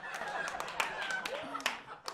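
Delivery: very high mock bawling; hammed-up wail dying away; hysterical sobbing. Hysterical owner after describing her lost dog, exaggerated bawling. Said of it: "She was hysterical".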